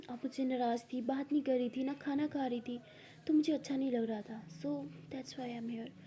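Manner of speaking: ordinary delivery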